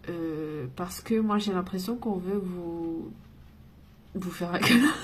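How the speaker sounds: ordinary talk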